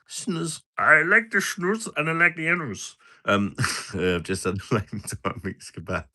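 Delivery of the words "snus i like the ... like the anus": silly sing-song voice. Pretend. Streamer joking about snus in a squeaky voice. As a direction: ham up a childish rhyme.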